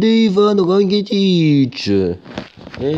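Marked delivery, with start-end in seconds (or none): 0.0-1.9 slow, stretched commentator shout; commentator goal call. Acted. Still calling the goal, stretched commentator shout. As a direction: drag the name out on the call.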